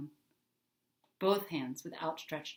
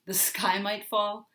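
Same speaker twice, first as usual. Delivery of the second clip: sharp mocking; 3 semitones higher, 7 dB louder, slower than usual. A narrator's reading. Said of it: "cut him off with a sharp laugh".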